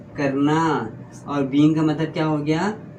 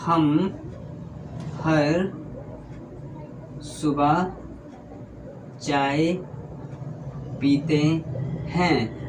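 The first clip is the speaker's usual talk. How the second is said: word by word with long pauses; dictating; 5 dB quieter, much slower than usual. Shown as a demonstration. Teacher writes the example on the board, dictating slowly. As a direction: spell it out slowly as you write.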